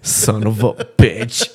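slow, drawn-out curse through laughter; amused, laughing exasperation. Real, not acted. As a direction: swear while cracking up. Cracking up at friend's joke.

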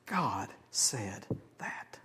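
breathy slow whisper of awe; awed whisper. Real, not acted. Preacher marvelling to the congregation in a slow whisper. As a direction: whisper it slowly, in wonder. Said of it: "hushed awe".

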